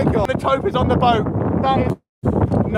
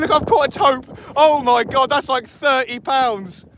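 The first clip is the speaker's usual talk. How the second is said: usual speaking voice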